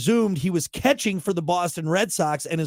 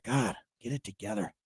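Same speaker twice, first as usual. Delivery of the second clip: muttered to self; 10 dB quieter than usual. Real, not acted. Scolding self off mic.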